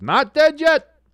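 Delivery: loud, high, triumphant shout of NOT DEAD YET; excited cry. Real, not acted. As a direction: burst out with fresh hope. Sudden burst of hope.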